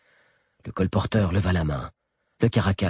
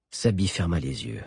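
plain reading voice

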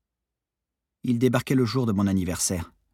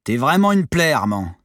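raised, high despairing reproach; exasperated; 6 semitones higher, 7 dB louder than usual. A narrator's reading. Monitor to lazy sailor, exasperated. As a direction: scold a hopeless pupil. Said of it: "Yo-Yo souffla, désespéré".

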